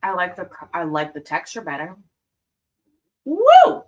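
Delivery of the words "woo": loud high whoop; excited whoop. Real, not acted. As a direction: whoop with delight. Tasting candy, sudden reaction.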